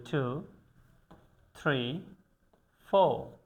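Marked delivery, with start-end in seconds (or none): none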